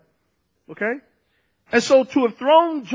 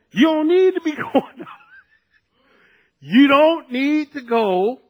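raised voice, breaking into a laugh; emphatic mock scolding; 5 semitones higher than usual. The voice scolds the fallen sister, mock emphatic. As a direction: hammer home a told-you-so, half amused.

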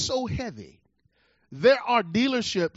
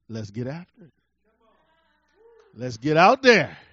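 still small voice; 6 semitones lower, 5 dB louder than usual. Retold or imitated. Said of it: "that still small voice".

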